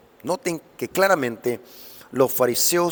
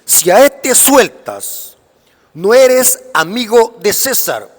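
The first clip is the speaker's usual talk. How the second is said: loud, high-pitched accusing crowd cry; raised voice, slow and emphatic; 6 semitones higher, 8 dB louder, slower than usual. Retold or imitated. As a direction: call it out forcefully, stretching the words. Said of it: "los judíos daban voces diciendo".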